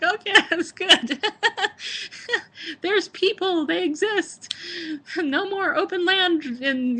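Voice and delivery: high, giddy laughing voice; giggly and high, relieved to see civilization; laughing relief. Retold or imitated. Acting out relief at seeing buildings.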